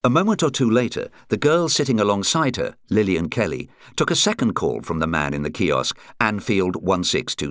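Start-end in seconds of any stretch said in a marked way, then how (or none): none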